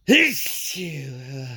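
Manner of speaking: sudden explosive achoo sneeze. Real, not acted. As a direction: let a big sneeze burst out. Sneezing fit on camera.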